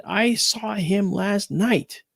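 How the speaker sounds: high, slow confession; mother admitting she saw him; mother's voice. Retold or imitated. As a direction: say it slowly in awe. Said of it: "my mother said".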